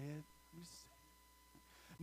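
breathy near-whisper; whispered aside. Real, not acted. Preacher muttering to himself before going on, whispered. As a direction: say it under your breath.

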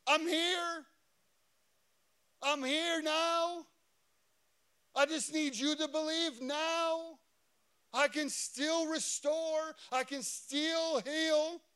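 high, loud, slow insistence; preacher acting an impassioned plea; Jesus pleading. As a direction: call out each phrase urgently, stretching the last word. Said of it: "Jesus is looking at her like".

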